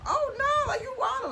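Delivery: very high "Oh, nah"; disbelief. Shown as a demonstration. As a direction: squeal of mock disbelief. Reacting, demo.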